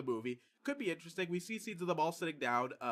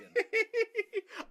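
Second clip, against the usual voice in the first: high laugh; 5 dB louder than usual. Real, not acted. Laughs at the question.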